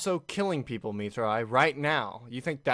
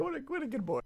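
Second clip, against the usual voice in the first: cooing; 13 semitones higher, 6 dB quieter, faster than usual. Real, not acted. Cooing over a character.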